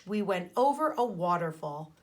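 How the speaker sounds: ordinary delivery, nothing marked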